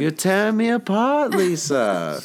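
high, strained Tommy Wiseau voice; Tommy Wiseau impression. Famous Room quote.

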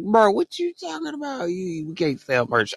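high, mocking bro what you talking about; incredulous retort. Retelling reaction to a YouTuber, high mocking disbelief. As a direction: scoff at him in disbelief. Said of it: "And then I was like".

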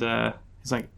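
ordinary talk, nothing marked

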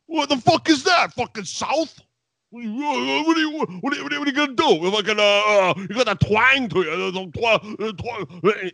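high, sputtering mock freak-out; Taz. Threatened commentator reacts to a Southern rival, imitated high flustered ranting. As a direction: trip over words in exaggerated agitation. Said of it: "watching Taz freak out".

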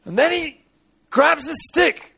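high-pitched dramatic sermon telling; impassioned preaching. Acted. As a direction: preach the story at full pitch. Preaches the story at full force.

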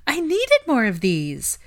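high, slow delighted gasp. A narrator's reading. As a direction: sigh it with delight. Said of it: "gasping in delight".

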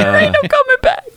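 very high squeaky falsetto; squeaky falsetto quote. Acted. Repeats quote even higher.

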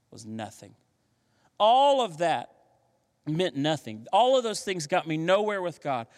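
ordinary talk, nothing marked

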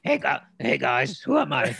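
loud muffled shout from inside a helmet. Pretend. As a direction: redo it, shouting through a helmet. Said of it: "Or no".

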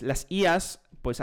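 ordinary talk

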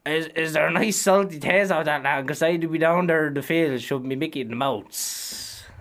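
deadpan hammed-up country lad; culchie coworker impression. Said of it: "he turns around and goes".

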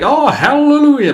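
hammed-up Southern hallelujah, high and loud; expected Southern accent. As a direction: belt it out like a Southern preacher. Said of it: "you'd expect to be like".

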